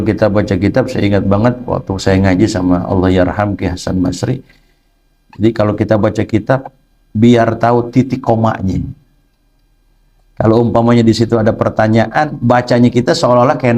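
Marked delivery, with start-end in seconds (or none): none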